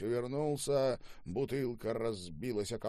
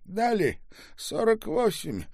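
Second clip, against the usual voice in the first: loud, high-pitched triumph; elated announcement; 13 semitones higher, 5 dB louder than usual. Acted. Driver reports the result to his boss, elated. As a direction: burst out with the good news. Character announces good news.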